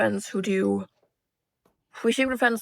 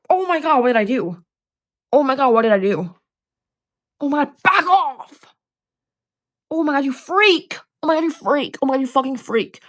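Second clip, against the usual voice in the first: panicked yelling; 4 semitones higher, 3 dB louder than usual. Pretend. Yelling at chainsaw killer.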